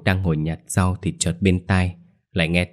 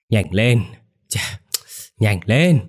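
ravenous crone; 3 semitones higher, slower than usual. A narrator's reading. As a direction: hurry her like a starving ghoul. Ravenous grandma urging.

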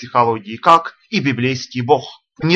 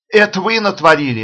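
higher, louder annoyed retort; irritated; 6 semitones higher, 4 dB louder than usual. A narrator's reading. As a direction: snap back in irritation. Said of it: "ответила она с раздражением". (с раздражением)

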